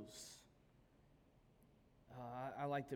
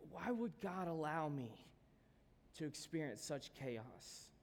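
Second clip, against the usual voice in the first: slow, heavy pleading questions; anguished questioning. Acted. Believer in a storm questioning God, anguished. Voicing a disciple caught in the storm.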